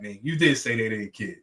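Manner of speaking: ordinary talk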